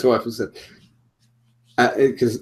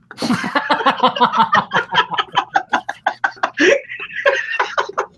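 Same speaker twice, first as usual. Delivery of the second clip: long, airy, wheezing laugh; breathless laughter; 12 semitones higher than usual. Real, not acted. After his crude wife joke, wheezing laugh.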